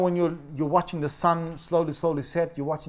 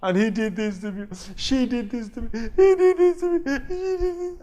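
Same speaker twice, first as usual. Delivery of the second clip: higher, rising into a loud sobbing whine; whiny crying kid; 7 semitones higher, 3 dB louder than usual. Retold or imitated. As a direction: imitate a child telling on a sibling. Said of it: "within seconds they can start crying".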